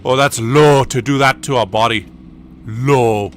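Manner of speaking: a hint of an old Irish man tutting in disgust; old Irish guy. Retelling Gangs of New York, an old Irishman tuts in light Irish brogue. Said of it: "this old Irish guy goes".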